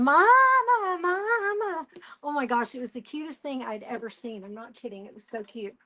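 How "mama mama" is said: high, bleating goat cry for mama; screaming baby goat. Retold or imitated. Goat running along fence, crying high for its mama.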